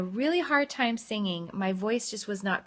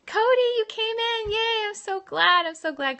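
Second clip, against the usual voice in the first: high, louder, thrilled welcome; high excited squeal of welcome; delighted greeting; 12 semitones higher, 4 dB louder than usual. Real, not acted. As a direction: cheer as a friend turns up. Greets friend who just joined.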